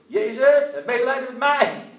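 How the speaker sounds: loud desperate cry for mercy. Retold or imitated. Blind beggar shouting to Jesus for mercy, loud desperate cry. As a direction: call out to Jesus at full voice. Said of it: "gaat hij roepen".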